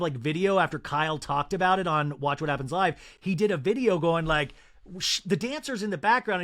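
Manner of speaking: normal talking voice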